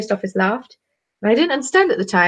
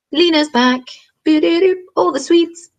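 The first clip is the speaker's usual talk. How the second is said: high, louder sing-song welcome; excited greeting; 9 semitones higher, 4 dB louder than usual. Real, not acted. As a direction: greet a friend with a cheer. Greeting returning viewer.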